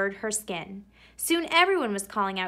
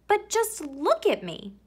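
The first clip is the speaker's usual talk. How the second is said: put-on higher whiny little-girl plea; girl's voice; 9 semitones higher than usual. Camilla showing mother her stripes, higher girlish plea. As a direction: point at herself like a dismayed kid. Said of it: "Camilla answered".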